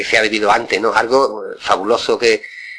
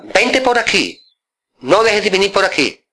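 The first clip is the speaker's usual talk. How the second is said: mock coaxing uncle voice, pitch up; uncle inviting, higher; 8 semitones higher than usual. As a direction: uncle urging the boy to come by. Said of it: "Le decía".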